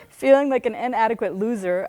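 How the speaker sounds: usual speaking voice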